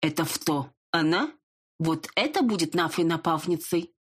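lisping stranger voice, slightly dismissive. Acted. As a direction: lisp every s like f. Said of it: "незнакомый шепелявый голос".

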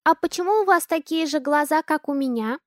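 put-on high little-girl voice, wondering; an innocent child noticing; curious child. A narrator's reading. Daughter asks the man about their eyes, childlike.

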